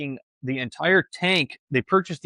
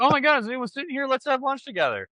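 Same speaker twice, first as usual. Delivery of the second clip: much higher, fast gushing voice; high, quick mock eager friend; eager acquaintance; 11 semitones higher than usual. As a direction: gush like someone inviting themselves. Voicing eager lunch acquaintance.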